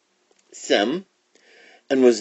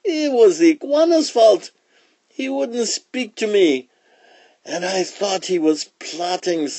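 python's cry; 9 semitones higher than usual. Acted. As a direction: plead innocence as an aggrieved python. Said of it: "he cried".

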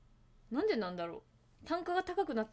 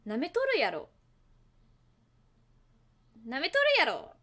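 louder, hammed-up fake Kansai, said twice; fake Kansai dialect; 4 semitones higher, 8 dB louder than usual. Shown as a demonstration. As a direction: play up a mock Kansai jab. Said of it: "エセ関西弁".

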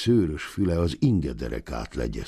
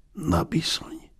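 a hissed whisper of pain; 13 semitones higher than usual. A narrator's reading. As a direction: hiss it through the teeth.

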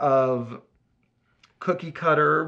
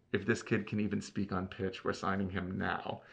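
low, hushed aside, much softer; retold whisper; 4 semitones lower, 9 dB quieter than usual. Director whispers to his boyfriend about the auditioner, hushed imitation. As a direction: drop it down as if conferring secretly. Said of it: "he whispered".

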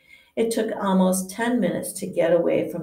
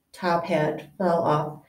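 ordinary delivery, nothing marked

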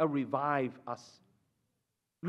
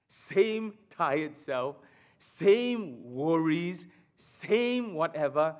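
weary slow drag; much slower than usual. Acted. Listing tired sameness.

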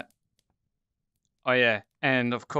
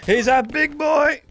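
raised, loud voice of disbelief; amazed exclamation; 7 semitones higher, 5 dB louder than usual. Real, not acted. Player amazed by a big enemy, high loud exclamation. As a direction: cry out at the sheer size.

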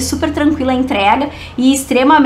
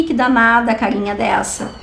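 normal talking voice

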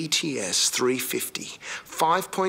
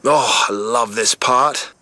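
loud, swelling pride; proud excitement; 5 dB louder than usual. Real, not acted. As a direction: present it like a national treasure. Proud reveal of Holden ute.

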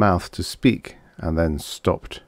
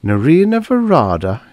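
high, loud delighted echo of a name; amused; 8 semitones higher, 5 dB louder than usual. A narrator's reading. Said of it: "he chuckled".

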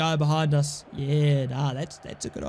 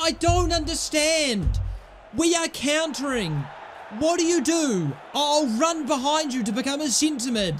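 raised, higher frustrated yelling at the team; exasperated shout; 6 semitones higher than usual. Real, not acted. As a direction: shout your disbelief at the players. Furious rant at own players.

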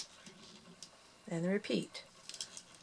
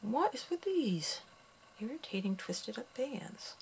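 exasperated; 4 semitones higher, 7 dB quieter than usual. Real, not acted. Frustrated with twisted bands.

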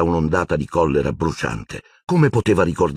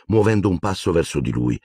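plain reading voice

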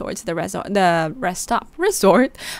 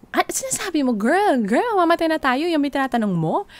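exasperated; 8 semitones higher than usual. Retold or imitated. Ed exasperated during crisis.

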